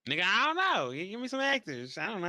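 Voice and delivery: high, loud burst of exasperation; exasperated protest. Real, not acted. Pressed to name a favourite actor, exasperated.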